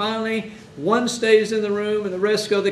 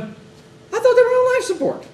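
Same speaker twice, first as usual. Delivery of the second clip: much higher, louder, incredulous quote; shocked family member; 6 dB louder than usual. Retold or imitated. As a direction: voice the family's disbelief way up high.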